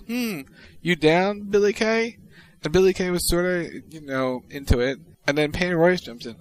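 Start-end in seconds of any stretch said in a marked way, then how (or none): 0.1-2.2 slow, teasing, rising invite. Retold or imitated. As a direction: coax Billy Kay playfully. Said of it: "She goes".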